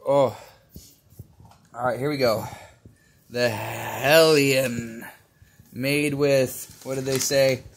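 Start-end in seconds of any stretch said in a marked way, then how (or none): 3.3-5.0 very slow, drawn-out announcement; dramatic. Real, not acted. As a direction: draw out the ominous name. Dramatic candy introduction.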